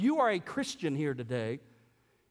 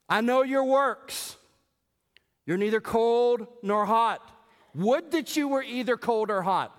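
scripture read with full force; 7 semitones higher, 5 dB louder than usual. Acted. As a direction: declaim the words of Jesus. Reads Revelation with full force.

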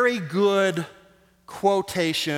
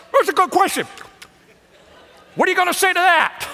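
much higher and louder, a hammed-up jeering shout; shouted heckle; 13 semitones higher, 6 dB louder, faster than usual. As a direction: yell it at the scribes like a gleeful heckler. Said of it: "impersonation of a corn dog being eaten".

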